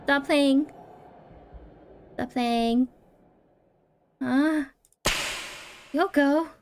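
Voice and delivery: halting, alarmed game dialogue; acted unease. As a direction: uneasily plead with a friend to stop. Voices character's unease at friend.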